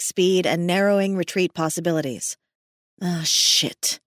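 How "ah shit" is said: muttered through clenched teeth; muttering through clenched teeth. A narrator's reading. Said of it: "she muttered through clenched teeth".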